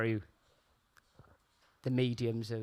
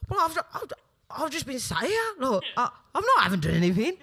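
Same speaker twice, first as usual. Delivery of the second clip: defensive Brummie; 8 semitones higher, faster than usual. Retold or imitated. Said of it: "He's like".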